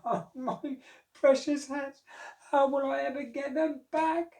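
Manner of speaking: high, slow mock wail; crying hat seller. Sad hat seller crying to the monkeys, high slow mock wail. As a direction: whimper it like a hammed-up sob. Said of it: "began to cry".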